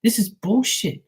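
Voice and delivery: imitating a furious sister griping; pissed-off sister. Sister ranting that he always gets a parking spot, high and exasperated. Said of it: "she's so pissed".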